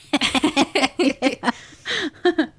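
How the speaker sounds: loud burst of laughter; laughing. Real, not acted. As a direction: crack up out loud. Laughing over Buffy Halloween costume idea.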